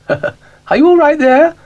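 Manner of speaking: amused lady cow calling over. Acted. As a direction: squeal it out with a laugh. Ermyntrude teases Dougal.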